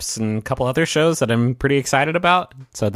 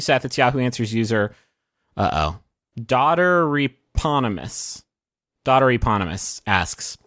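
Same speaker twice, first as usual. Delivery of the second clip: normal talking voice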